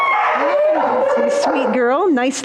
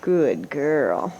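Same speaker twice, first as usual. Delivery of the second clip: talking to a dog; 5 semitones lower, much slower than usual. Real, not acted. Praising the dog.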